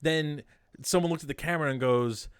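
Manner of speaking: usual speaking voice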